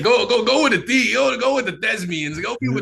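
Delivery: high, loud, laughing mock of the teacher. Imitating his teacher packing him off to theater class, high and laughing. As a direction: ham up the teacher shooing him off to the thespians.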